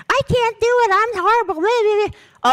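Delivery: high, whiny mocking imitation; mock angst. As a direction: mimic the letter writer's fretting in a high voice. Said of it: "All that angst".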